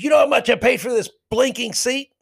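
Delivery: high-pitched, indignant mock shout; retold indignant fan. Heckler turning on the man behind him, indignant. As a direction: throw it back as an outraged challenge. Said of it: "he turned around and looked at me".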